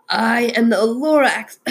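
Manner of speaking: Lorax announcing himself through a wheeze; coughing, wheezing. A narrator's reading. Lorax back again with gripes, wheezing. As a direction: say it as a sick little creature. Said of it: "he coughed and he wheezed".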